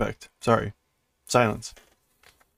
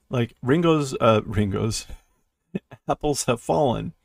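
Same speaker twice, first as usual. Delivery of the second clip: ordinary delivery, nothing marked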